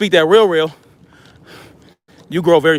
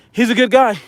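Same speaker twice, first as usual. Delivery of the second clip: higher-pitched amateur impression voice; Donald Trump; 5 semitones higher than usual. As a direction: badly imitate a politician, pitched up. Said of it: "Trump voice like some people".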